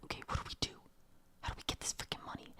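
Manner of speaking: barely voiced whisper, very soft; urgent whispered plotting; hushed scheming. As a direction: whisper it like a secret huddle. Scheming at the salon over money.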